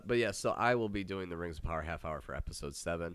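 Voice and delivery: ordinary delivery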